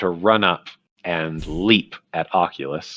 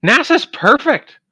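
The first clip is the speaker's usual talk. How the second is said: very high, louder squeaky insistence; devoted ermine; 12 semitones higher, 5 dB louder than usual. Acted. Ermine insisting its dead friend is perfect, high squeaky voice. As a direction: squeak it with stubborn loyalty.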